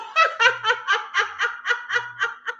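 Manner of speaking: high rhythmic forced laugh; Gouda laughter. Shown as a demonstration. Host demonstrates Gouda laughter, high pulsing laugh. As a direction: laugh deliberately in high, even pulses. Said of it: "Gouda laughter".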